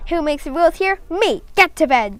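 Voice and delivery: high, loud screech. A narrator's reading. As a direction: screech the orders. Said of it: "Tina screeched".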